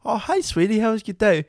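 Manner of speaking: high, sugary greeting; sweet parent voice. Retold or imitated. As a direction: coo it like a loving parent. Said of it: "You're like".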